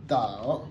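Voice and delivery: "dao" low, dipping then rising; "dao" as a falling-rising syllable; unchanged third tone. Shown as a demonstration. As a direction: drop "dao" low and curve it up. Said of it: "le troisième reste tel".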